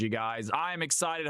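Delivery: usual speaking voice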